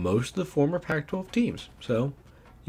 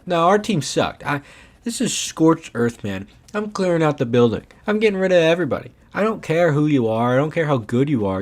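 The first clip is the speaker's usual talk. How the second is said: louder, higher mock fury; fed-up rant; 5 semitones higher, 5 dB louder than usual. Gamer to viewers after a bad season, ranting in loud mock fury. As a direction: clear out the whole building, half-joking. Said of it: "I'm angry".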